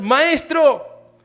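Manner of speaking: high, loud desperate cry; frightened disciples shouting at Jesus in the storm; shouting in fear. Acted. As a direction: yell it over the storm. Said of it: "Dice que le gritaron".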